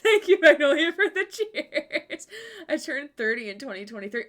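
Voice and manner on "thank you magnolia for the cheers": thanks spilling out through giggles; loud laughing thank you. Real, not acted. Thanking viewer while laughing.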